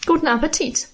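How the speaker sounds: mildly snide maid's send-off; snippy. A narrator's reading. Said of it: "sagte schnippisch".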